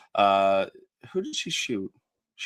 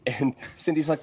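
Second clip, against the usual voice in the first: usual speaking voice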